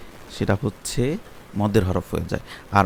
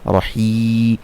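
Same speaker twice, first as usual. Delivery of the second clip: usual speaking voice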